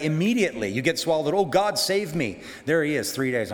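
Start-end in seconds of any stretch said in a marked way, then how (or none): 1.3-2.4 high, drawn-out cry to God; slow, higher-pitched dramatized plea; mock desperate plea. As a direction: act out a panicked prayer for rescue. Acts out swallowed man's panicked prayer.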